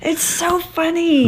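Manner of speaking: high, slow insistent plea; beg, stretching the words out high; real drawn-out wheedling; pleading. Real, not acted. Begs him to do the voice.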